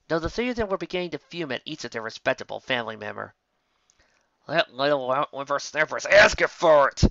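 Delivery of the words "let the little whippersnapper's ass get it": louder, sharp country snap; snapped. A narrator's reading. Applejack snapping as she gallops after her sister. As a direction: bite the words off angrily. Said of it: "Applejack snapped".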